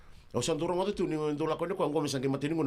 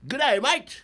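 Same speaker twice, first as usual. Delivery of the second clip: loud, high Aussie greeting; hammed-up Australian welcome; Australian accent; 5 semitones higher, 6 dB louder, slower than usual. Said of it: "Wa, wa Australia ni".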